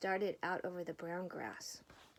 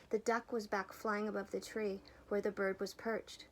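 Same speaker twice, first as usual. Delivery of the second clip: plain reading voice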